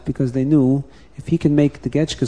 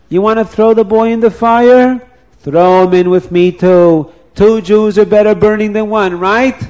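higher, louder mocking dare; defiant taunt; 7 semitones higher, 4 dB louder than usual. Old man daring the mob, higher and louder. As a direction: dare them to burn him too.

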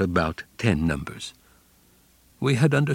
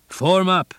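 higher, sharp shouted command; barked order; 8 semitones higher than usual. A narrator's reading. Camp command yelling for the men to form up. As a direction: call the men to line up at once.

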